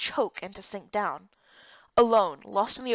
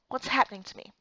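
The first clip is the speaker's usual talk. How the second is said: ordinary delivery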